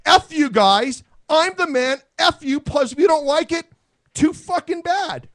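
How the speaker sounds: loud defiant mock tough-guy leader. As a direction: bark it like a leader who won't back down. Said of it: "if the guy said".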